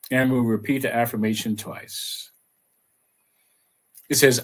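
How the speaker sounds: ordinary talk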